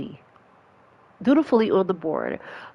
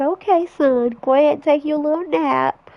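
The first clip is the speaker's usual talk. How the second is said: higher, brighter mom sending him off; 8 semitones higher than usual. Acted. Mom sees son off to school, high cheerful voice. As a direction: play the cheerful mother, pitched up.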